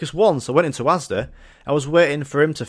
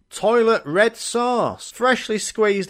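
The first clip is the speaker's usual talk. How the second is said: slow mock menu announcement; 8 semitones higher, much slower than usual. Mocking McDonald's sauce.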